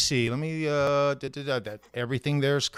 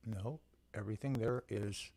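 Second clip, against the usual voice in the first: scrambled voice; 5 semitones lower, 15 dB quieter than usual. Real, not acted. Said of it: "your audio is garbled".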